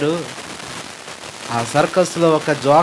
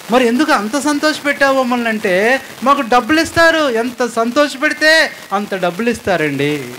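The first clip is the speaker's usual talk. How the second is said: acted joker dialogue; 8 semitones higher than usual. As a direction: belt out the joker's answer. Acted doctor and joker exchange.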